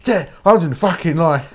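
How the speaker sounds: yelling at queue jumpers; loud, high kid's yell calling someone out; shout. Retold or imitated. A little kid shouting at a queue jumper. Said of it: "I'll be like".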